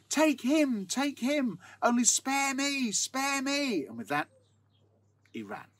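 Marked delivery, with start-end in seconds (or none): 0.1-3.9 high, loud pleading cry for mercy; terrified cry. A narrator's reading. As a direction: beg for your life in terror. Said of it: "and cried out".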